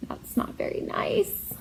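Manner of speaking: soft unvoiced aside; whisper. Teacher reading, whispering the sister's reproach. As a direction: breathe it out under your breath.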